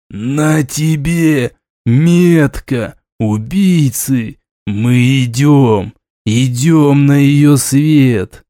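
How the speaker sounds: very slow, dragging drawl; slow, heavy, distant voice; тянет слова. Acted. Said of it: "растягивая слова". (медленно, растягивая слова)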